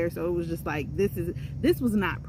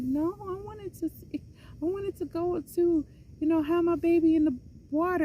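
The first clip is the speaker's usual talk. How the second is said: high, pleading whine rising louder at the end; whiny pleading; 12 semitones higher, 4 dB louder than usual. Retold or imitated. Pregnant woman pleading with the nurse in a high whine. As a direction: beg like a disappointed kid. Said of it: "And I'm like".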